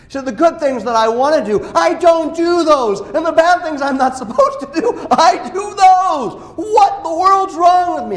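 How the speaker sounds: a believer venting about doing the bad things he hates; exasperated outburst. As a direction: throw up your hands at yourself. Exasperated self-argument.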